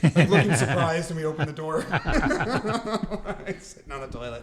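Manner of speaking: loud, high, cracking up while talking; laughing. Real, not acted. Host picturing Jimmy caught in the bathroom, laughing. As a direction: push the joke out through real laughter.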